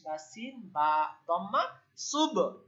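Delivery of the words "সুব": higher সুব with the ব released in a small echo; সুব ending on a bounced b; qalqalah bounce. Shown as a demonstration. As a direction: push off the final ব of সুব. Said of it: "এই যে ধাক্কা দিলাম".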